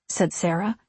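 usual speaking voice

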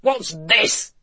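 irate little man's character voice; testy demand in a put-on voice. A narrator's reading. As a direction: snap it out, mildly irate. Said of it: "an irate elf".

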